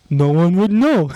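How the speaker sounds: slow mock Serbian accent, pitched a touch up. Podcaster quoting a film gangster for his co-hosts, Serbian accent. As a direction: draw it out in a put-on Serbian accent. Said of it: "See, I can do Serbian".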